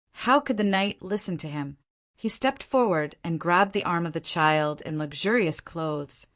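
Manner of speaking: ordinary delivery, nothing marked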